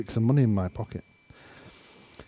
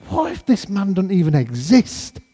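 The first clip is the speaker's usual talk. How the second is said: high, drawn-up anxious question; mock-anxious, higher voice; worried question; 7 semitones higher than usual. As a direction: retell a sudden panic, pitch climbing. Retold worry at airport drop-off.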